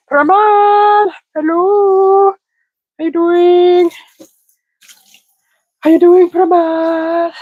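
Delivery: high, bright call-out to a viewer; excited high greeting. Real, not acted. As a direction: call hello up high, delighted.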